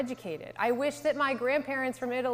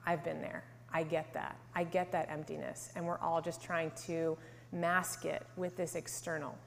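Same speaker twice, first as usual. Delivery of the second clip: soft, lower murmur of recognition; moved inner thought; 3 semitones lower, 7 dB quieter than usual. Retold or imitated. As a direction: retell a private realisation quietly. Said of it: "felt that tightness in my throat".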